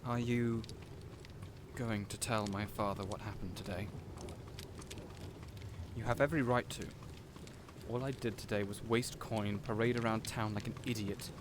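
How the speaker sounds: a humbled, hushed apology to the guard; subdued regret. Acted. Asking guard not to tell.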